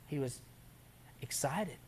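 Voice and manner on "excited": ordinary talk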